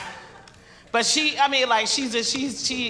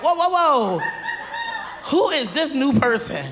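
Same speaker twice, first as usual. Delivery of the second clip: higher, startled whoa; mock startled; 6 semitones higher than usual. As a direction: pull back in mock shock. Reacting to new braids.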